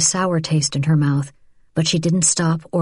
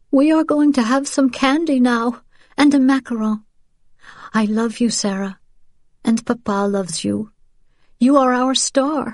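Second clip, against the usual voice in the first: high, tearful and breaking; 7 semitones higher than usual. A narrator's reading. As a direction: hold back tears, failing. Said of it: "Rachel's voice broke".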